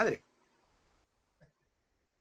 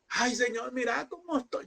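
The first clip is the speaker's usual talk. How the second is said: higher, self-pitying moan; mock whining complaint; 9 semitones higher than usual.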